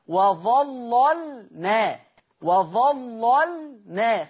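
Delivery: وظللنا slow, thick first lam, thin second lam; tajweed demo. Shown as a demonstration. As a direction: recite وظللنا slowly, light sukun lam. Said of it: "خلي بالكم من ترقيق اللام الساكنة".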